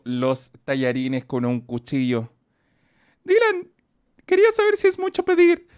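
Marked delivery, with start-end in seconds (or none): 3.2-5.7 much higher, sweet mock asking voice; high put-on fan voice; mock viewer voice. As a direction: read it as a pleading kid. Reads a viewer message aloud.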